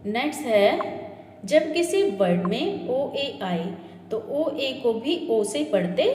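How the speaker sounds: normal talking voice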